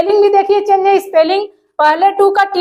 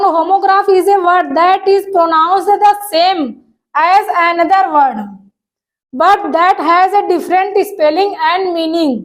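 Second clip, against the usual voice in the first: slow dictation reading; much slower than usual. Shown as a demonstration. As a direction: read the definition very slowly, word by word. Reads English definition slowly. (धीमे-धीमे परिभाषा पढ़कर सुनाना)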